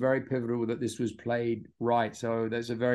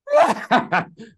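burst of laughter; 11 semitones higher, 7 dB louder than usual. Real, not acted. Recalling the giggling on set.

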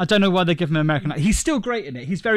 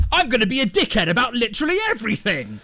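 high-pitched hammed-up obnoxious douchebag; mock a one-dimensional villain in a high voice; pantomime villain; 7 semitones higher than usual. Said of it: "He's like".

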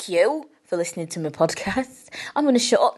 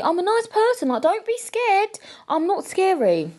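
sugary little voice to calm nervous fans; cutesy reassurance; 11 semitones higher than usual. Pretend. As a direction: play it cute and harmless. Reassuring listeners she's not scary.

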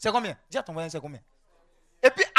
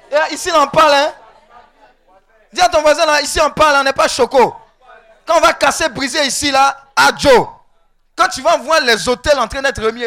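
loud, raised-pitch exhortation; full-force preaching; 5 semitones higher, 13 dB louder than usual. Real, not acted. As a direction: drive it home to the congregation.

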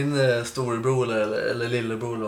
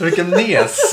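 loud, higher, laughing jeer; laughing mock insult; 8 semitones higher, 10 dB louder, slower than usual. Teller joking about dissing her brother, laughing mock insult. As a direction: toss out the insult through laughter.

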